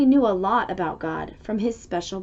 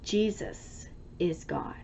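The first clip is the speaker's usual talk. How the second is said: soft whisper, slow; hushed final truth for the children; whispered reveal; 4 semitones lower, 10 dB quieter than usual. A narrator's reading. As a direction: whisper it like a precious secret. Story's closing revelation.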